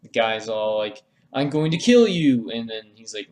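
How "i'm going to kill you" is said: higher, louder hammed-up yell; mock threat. Dungeon guy threatening Tingle, hammed-up yell. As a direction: bellow it like a cartoon villain.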